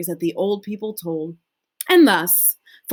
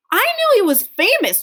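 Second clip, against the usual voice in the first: put-on eager child voice; 14 semitones higher, 5 dB louder, faster than usual. Charlie protests.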